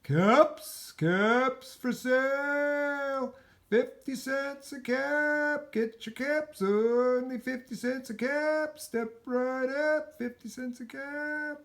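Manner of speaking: high, loud street-seller call. Acted. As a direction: call out to buyers like a peddler.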